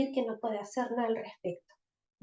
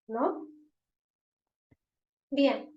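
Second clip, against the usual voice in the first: normal talking voice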